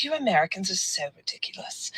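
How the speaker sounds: soft, quick imitation British accent; lightly mocked British accent. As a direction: mimic a scoffing Brit under your breath. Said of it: "And they're British".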